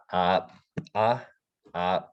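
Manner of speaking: "uh" popped and unvoiced after the catch; popped glottal syllables. Shown as a demonstration. Teacher demonstrating popped glottal letters. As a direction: pop each "uh" out with no voice after. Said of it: "it just pops".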